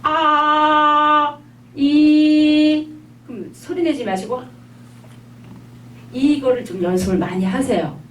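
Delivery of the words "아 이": 아 then 이, high and loud; long high 아, long 이; Chinese a and i. Shown as a demonstration. Teacher modelling 아 and 이 for students to copy. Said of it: "한번 해보세요".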